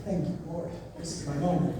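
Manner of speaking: a quiet, low inner prayer of relief; hushed thanks. Retold or imitated. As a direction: murmur it to yourself, low and soft. Grateful inner prayer when chance comes.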